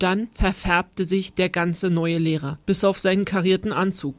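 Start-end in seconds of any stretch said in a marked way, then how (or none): none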